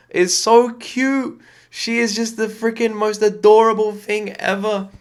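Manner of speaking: high, loud gushing over something cute. Real, not acted. Vlogger gushing over a clip of little Taylor, high and loud. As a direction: squeal it like you're melting.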